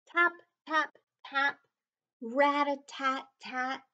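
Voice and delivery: high, crisp rat-a-tat-tat; woodpecker tapping. A narrator's reading. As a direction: tap it out like a woodpecker. Woodpecker sound in story.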